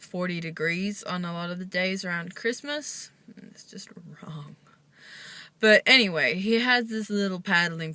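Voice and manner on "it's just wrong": whisper. Real, not acted. Aside about Australian heat.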